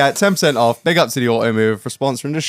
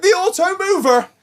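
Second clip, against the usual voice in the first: high, showy announcer call of the Auto Mover; big ad-read flourish; hyped announcer; 13 semitones higher than usual. Acted. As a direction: sell the sponsor name like a jingle. Announcing sponsor name.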